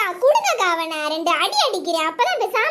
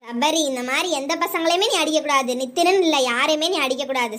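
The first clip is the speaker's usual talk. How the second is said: ordinary talk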